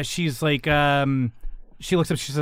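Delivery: ordinary talk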